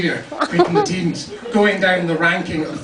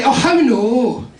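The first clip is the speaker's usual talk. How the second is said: drawn-out, higher Dundee protest; Dundee schoolboy; 5 semitones higher, 4 dB louder, much slower than usual. Acted. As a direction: whine it slowly like a Dundee lad. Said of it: "the wee boys would come up".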